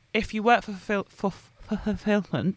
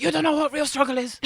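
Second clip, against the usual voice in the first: higher, louder strict mother voice; scolding mum; 7 semitones higher, 5 dB louder than usual. Retold or imitated. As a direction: play a stern Hong Kong mum telling off. Mum scolding a whinging child.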